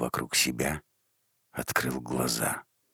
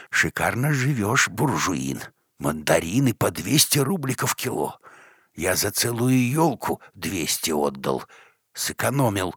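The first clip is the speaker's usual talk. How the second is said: mocking old man; 6 semitones higher, 4 dB louder than usual. A narrator's reading. Old man mocks Pukhov.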